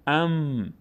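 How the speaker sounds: ام with a long held hum on the m; n turned to m. Shown as a demonstration. Teacher shows the replaced sound, ام with lengthened m. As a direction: hold the m of ام slowly.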